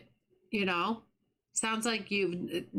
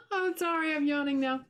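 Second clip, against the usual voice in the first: laughing apology; 7 semitones higher than usual. Real, not acted. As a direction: giggle the apology out.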